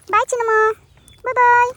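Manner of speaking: loud, high sing-song goodbye to the pet; loud high farewell to the animal; calling bye. Real, not acted. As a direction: wave off the pet with a bright call.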